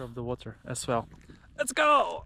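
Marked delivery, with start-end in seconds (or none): 1.6-2.2 excited. Real, not acted. Cheering himself on.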